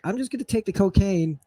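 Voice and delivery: high, casual quoted line; quoting the line. Retold or imitated. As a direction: toss the line off, breezily high. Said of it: "there's a line".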